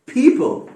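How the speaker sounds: पीपल high and loud; loud model of पीपल; emphatic demo. Shown as a demonstration. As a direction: punch out पीपल, aspirated P. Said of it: "लोग यानी पीपल".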